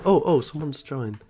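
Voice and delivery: ordinary talk, nothing marked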